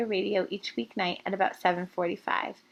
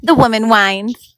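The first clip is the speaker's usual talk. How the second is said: lively read-aloud narration; story narration; 12 dB louder, slower than usual. A narrator's reading. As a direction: read it like a bedtime chapter. Narrator reads chapter aloud.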